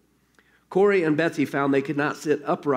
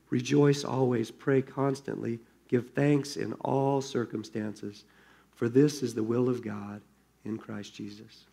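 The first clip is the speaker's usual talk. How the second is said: quiet reading; 4 semitones lower, 6 dB quieter than usual. A narrator's reading. Reading scripture softly, slowing.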